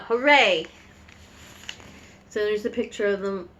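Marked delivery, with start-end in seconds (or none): 0.0-0.7 witches' cheer. Acted. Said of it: "bitches shout".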